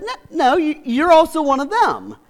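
accusing bystander. Retold or imitated. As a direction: corner him with it. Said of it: "someone else saw him and said".

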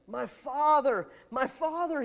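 high, loud anguished cry. Acted. King weeping over the prophet, crying out loud. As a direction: wail it like a grieving son. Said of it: "he cried".

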